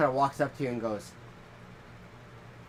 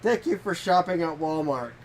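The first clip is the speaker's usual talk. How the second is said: imitating goofy kid; 4 semitones higher, 5 dB louder, slower than usual. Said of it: "he, like, does the whole, covers his nose".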